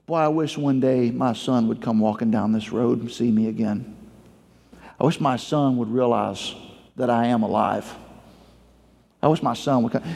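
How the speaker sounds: low, wistful longing; a father's subdued, low wishing; musing to himself. Acted. Lonely father wishing for his son's return, low and wistful. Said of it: "perhaps he says to himself".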